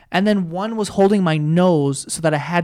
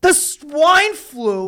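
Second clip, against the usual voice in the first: surprised; 8 semitones higher, much slower than usual. Real, not acted. Incredulous repeat of swine flu.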